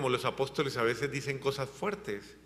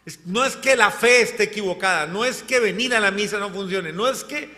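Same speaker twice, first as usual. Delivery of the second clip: loud, raised voice, pressing each point; preached rebuke; 5 semitones higher, 5 dB louder than usual. Acted. Apostle scolding his community, loud preaching rebuke.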